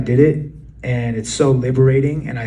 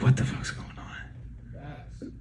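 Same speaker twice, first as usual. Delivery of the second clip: slow, breathy whisper to himself; inner doubt; 11 dB quieter, much slower than usual. Retold or imitated. Artist voices his own imposter doubt, whispered. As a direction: let the confusion slip out under your breath.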